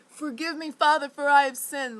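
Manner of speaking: high, loud scream of a confession; screaming a confession into the storm. Retold or imitated. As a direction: belt it out at the sky. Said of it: "I screamed it really loud".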